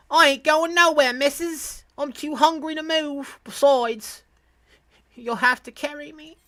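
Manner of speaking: put-on high-pitched British kid, too hungry to budge; British accent. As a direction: put on a high English child's voice. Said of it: "like, a British Englishman accent".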